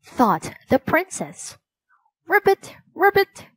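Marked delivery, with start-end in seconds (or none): 2.3-3.5 high, loud frog croak; a little frog demanding, high and loud. A narrator's reading. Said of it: "the frog kept croaking".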